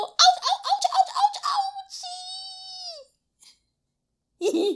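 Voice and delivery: very high laughing ouches; high playful ouch ouch. Shown as a demonstration. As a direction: yelp like a pinched toe, playfully. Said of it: "can you go".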